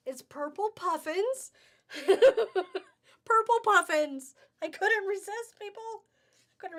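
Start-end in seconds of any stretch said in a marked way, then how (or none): 0.1-1.5 high, louder delighted squeal of words; giddy excitement. Real, not acted. Seller reveals a cute fabric to shoppers, giddy excitement. 1.9-2.8 loud, high burst of laughter; delighted laugh. Real, not acted. Seller cracks up at her cute fabric, delighted laugh. 3.2-5.8 high, loud, giddy exclamation; gleeful excitement. Real, not acted. Seller confesses to shoppers she couldn't resist, gleeful excitement.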